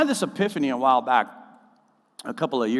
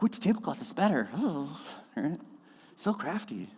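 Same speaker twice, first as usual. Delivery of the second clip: a hint of a cooing crafter, much softer; Pinterest voice; 3 semitones higher, 8 dB quieter than usual. Pastor teasing his own family's tablescape, softer. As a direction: softly coo over the tablecloths. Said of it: "That's my Pinterest voice".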